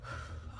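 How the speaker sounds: low, heavy exhale; calming-down sigh. Real, not acted. Overcome reliving her brother's danger, sighing to calm down. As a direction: let out a real sigh to settle. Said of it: "I had to calm down".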